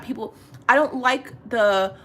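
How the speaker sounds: ordinary talk, nothing marked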